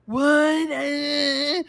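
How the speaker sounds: high, loud drawn-out bafflement; hammed-up wail of confusion; mock clueless drivers. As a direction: imitate hopelessly confused people, stretching it out. Imitating clueless stuck drivers.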